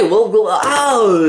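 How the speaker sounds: slow, high, loud choking cry; hammed-up death groan. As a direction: die theatrically, drawn out.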